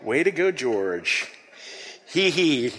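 ordinary delivery

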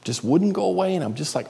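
normal talking voice

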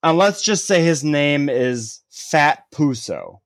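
drawn out, slow and sly; slow, sly storyteller's tease; slow teasing reveal. Pretend. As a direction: drag out the made-up name slowly. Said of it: "just throwing a random name out there".

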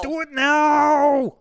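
very high, slow, loud drawn-out yell; Arnold shout. Retold or imitated. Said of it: "a lot of Arnold quotes".

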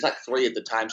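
ordinary talk

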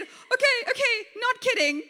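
high, loud, hammed-up panic; frantic storybook Moses giving in; cowering. A narrator's reading. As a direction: back down in comic fright. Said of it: "Moses cowered in the corner and said".

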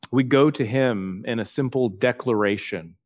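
usual speaking voice

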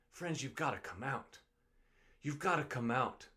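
grieving plea to the faithful; choked-up plea. Real, not acted. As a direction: let it fall away, moved, almost breaking. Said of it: "I'm sorry I get emotional about this".

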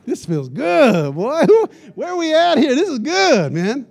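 loud, high, slow relish of the moment; delighted gloating. Retold or imitated. Preacher retells his glee at being escorted past the line, loud and delighted. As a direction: savor being VIP, big and gleeful. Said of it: "I was like".

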